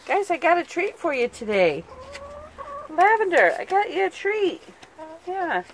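high, sing-song voice for the hens; sweet pet talk. Real, not acted. Owner calling her hens with a treat in high sweet tones. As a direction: coo a treat offer to the chickens.

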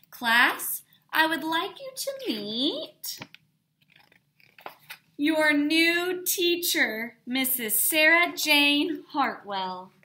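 grand announcement. A narrator's reading. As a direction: build up to the big reveal. Principal announces new teacher.

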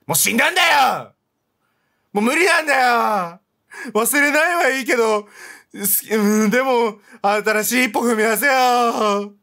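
mock-furious rant at the screen; frustrated shout. Real, not acted. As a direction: shout him out of mourning. Scolding the game character loudly.

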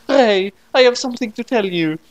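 Noami tells Ray she is pregnant, high and dramatic; Noami's voice. Acted.

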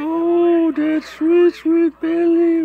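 excited coaxing. Real, not acted. Loud fast coaxing of ball.

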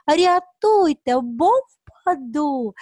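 loud, high, drawn-out plea; acted cry for help. Teacher voices a rhyme for children, loud cry for help. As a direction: call out slowly as if about to fall.

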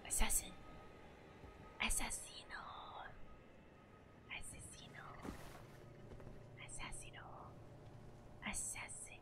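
breathy ASMR whispering. Pretend. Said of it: "As A S M R".

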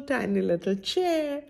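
plain reading voice

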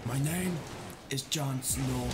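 measured Game of Thrones character voice; Jon Snow. Acted. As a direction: play the brooding northern lord. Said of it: "post my Jon Snow picture".

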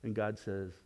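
ordinary talk, nothing marked

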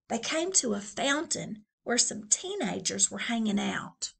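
ordinary delivery, nothing marked

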